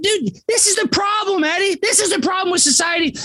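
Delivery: high-pitched exasperated outburst. Real, not acted. Speaker venting to Eddie about the problem with society, exasperated.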